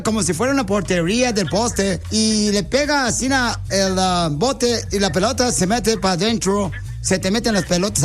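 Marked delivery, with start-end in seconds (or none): none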